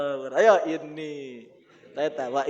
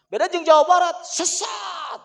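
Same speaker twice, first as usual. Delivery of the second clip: mock shout; 9 dB louder than usual. Mocking the West Java view.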